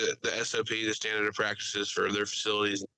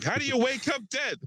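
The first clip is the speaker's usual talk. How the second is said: much higher, hammed-up movie quote; Steve Buscemi; 13 semitones higher than usual. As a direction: act out the baffled film line, pitched way up. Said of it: "it's a Steve Buscemi, uh, line".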